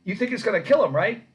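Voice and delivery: loud, high showman line; booming salesman tease; salesman pitch. Retold or imitated. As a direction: tease the crowd like a huckster. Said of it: "he goes".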